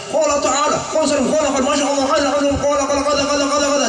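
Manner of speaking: lightly imitated ustaz rattling off Arabic; mock Arabic babble. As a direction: parody a preacher spouting Arabic.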